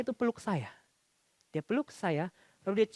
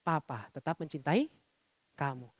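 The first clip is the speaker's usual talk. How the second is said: tender slow reassurance; 4 semitones lower, 7 dB quieter than usual. Retold or imitated. Repeats father's words for congregation.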